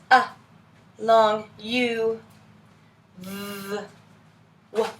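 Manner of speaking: isolated letter sounds, pure phonics; sounding out letters. Shown as a demonstration. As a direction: say each letter's sound, no added vowel. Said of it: "sound out each letter".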